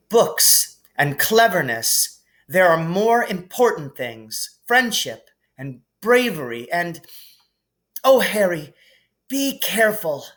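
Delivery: begging him to be safe; worried Hermione. Acted.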